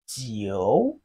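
"jiu" dipping low, then rising; third tone. Shown as a demonstration. Teacher models Chinese nine for learners, third-tone "jiu". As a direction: draw out "jiu" from low pitch to a rise. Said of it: "It has the third tone".

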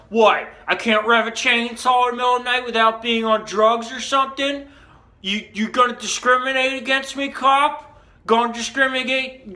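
high, loud accusatory yelling; acted indignant rant. Accusing the cop of discrimination, acted indignant shouting.

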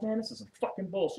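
venting to the cellmate; complaining to his cellmate, fed up. Retold or imitated. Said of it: "I'm like".

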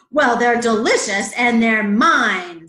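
loud, insistent frog; possessive bully. A narrator's reading. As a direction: lean hard on the claim of ownership. Bully frog claims the lilies.

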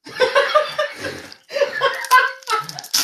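long, high-pitched laugh; high laughing fit. Real, not acted. Co-host reacting to the hot pot joke, high helpless laugh.

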